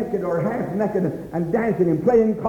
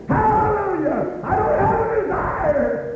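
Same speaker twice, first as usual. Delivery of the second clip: high, slow rising shout of praise; long high joyful cry; ecstatic hallelujah; 12 semitones higher than usual. Real, not acted. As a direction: fling it up to heaven. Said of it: "preacher cries out".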